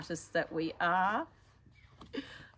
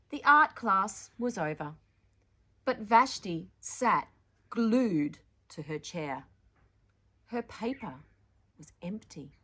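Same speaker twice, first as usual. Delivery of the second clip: put-on louder, expressive read-aloud to children; story narration; 2 semitones higher, 7 dB louder than usual.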